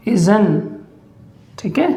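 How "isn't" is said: isn't said as "iz-un"; isn't with the t dropped; saying isn't. Shown as a demonstration. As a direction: clip isn't to "iz-un". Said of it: "बोलेंगे".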